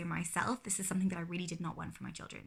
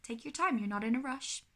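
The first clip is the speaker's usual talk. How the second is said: higher, calm reassurance; reassuring; 5 semitones higher, 3 dB louder than usual. Shown as a demonstration. Parent reassuring climbing child to take his time. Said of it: "I'll maybe say".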